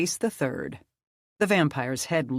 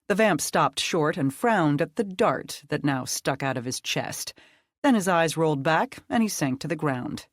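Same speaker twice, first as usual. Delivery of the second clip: usual speaking voice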